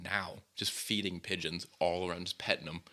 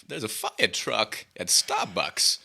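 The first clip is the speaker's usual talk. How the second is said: slow, loud, halting Walken cadence; Christopher Walken impression; 7 dB louder, much slower than usual. Telling a friend about the fire truck, in a slow loud Walken voice. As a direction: stretch each word out and land it hard, Walken style. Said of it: "I a hundred percent Christopher Walkened".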